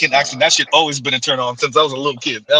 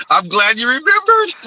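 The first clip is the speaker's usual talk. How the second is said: amused, higher-pitched reply; laughing; 4 semitones higher than usual. Real, not acted. Cohost reacting to a friend's punchline, laughing. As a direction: say it just after a burst of laughter.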